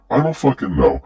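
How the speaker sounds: high-pitched bafflement; exasperated. Real, not acted. Commentator answering why he keeps his platforms, exasperated. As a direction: throw it up high, at a total loss.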